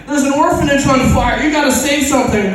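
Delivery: high, loud cry for rescue; panicked citizen calling for help; urgent plea. Acted. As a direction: plead loudly with a hero. Acted plea to superhero for rescue.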